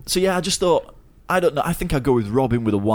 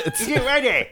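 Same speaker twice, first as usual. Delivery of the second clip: grumpy husband. Pretend. Husband asking for tea.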